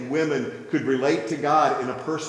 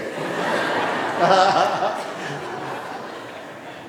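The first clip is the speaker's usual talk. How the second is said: laugh; 4 semitones higher than usual. Real, not acted. Laughing at his own story.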